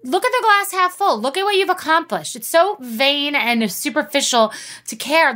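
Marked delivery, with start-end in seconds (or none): 0.0-2.4 much higher, impatient telling-off of a friend; exasperated pep talk. Retold or imitated. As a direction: snap her friend out of self-pity. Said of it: "I'm like".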